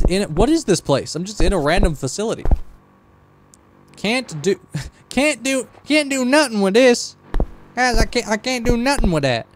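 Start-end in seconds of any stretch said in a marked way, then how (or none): 3.9-9.5 high-pitched country twang, played for laughs. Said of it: "I also don't really know what this accent is".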